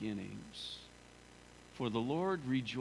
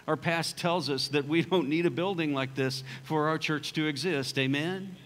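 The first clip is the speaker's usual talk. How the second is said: higher, louder preaching climax; full-force preaching; 4 semitones higher, 6 dB louder than usual. Acted. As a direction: drive it home to the congregation.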